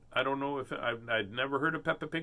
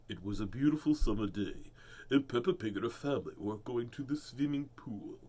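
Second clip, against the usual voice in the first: put-on lower, lilting read-aloud voice for children; storybook reading voice; 4 semitones lower, 3 dB quieter than usual. Shown as a demonstration. Shows her reading voice, storybook narration. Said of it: "my voice changes".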